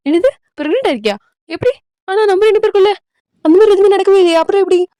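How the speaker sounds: high-pitched, incredulous questioning; shocked disbelief. Acted. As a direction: question it as if it's impossible. Acted shock at pregnancy news.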